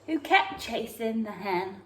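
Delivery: ordinary delivery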